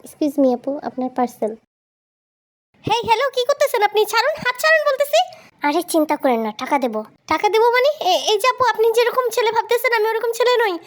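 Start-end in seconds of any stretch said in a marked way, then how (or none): 2.8-5.2 fast, very high panicked objection; alarmed protest. Acted. Delivery man grabbed by customer, alarmed. 7.3-10.8 high, indignant objection; offended protest. Acted. Delivery man refusing woman's money, offended. Rejects improper offer of money.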